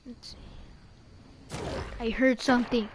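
usual speaking voice

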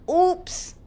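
a high, loud oops as the warthog realizes the crash; sheepish oops. Acted. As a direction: squeak out a clumsy oops. Pumbaa crashes into Timon.

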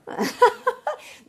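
high, loud happy giggle; delighted laugh. Real, not acted. Delighted by her son.

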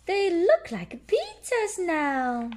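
very high, slow, loud piglet boast; Peppa voice. A narrator's reading. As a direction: boast like a proud little pig girl. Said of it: "says Peppa".

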